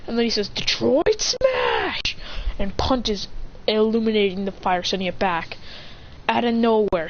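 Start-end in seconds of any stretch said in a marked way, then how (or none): 0.5-2.1 high, drawn-out attack call; high-pitched signature move shout at normal volume; battle cry. Acted. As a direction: call out a superhero finishing move, stretched long. Acted attack call in story.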